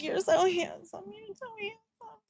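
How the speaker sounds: high, soft mock swoon; flirty swooning. Mocking the woman fawning over Charlie, high soft coo. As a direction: coo it like a smitten admirer. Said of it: "She's like".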